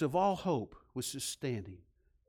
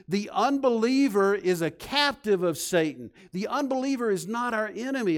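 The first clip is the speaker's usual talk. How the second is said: louder, higher, insistent sermon climax; preaching at full force; 5 semitones higher, 6 dB louder than usual. Acted. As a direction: drive the message home to the congregation.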